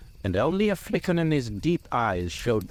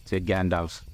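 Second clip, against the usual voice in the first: plain reading voice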